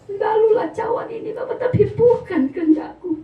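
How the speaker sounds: pleading prayer. Retold or imitated. Jesus praying in Gethsemane.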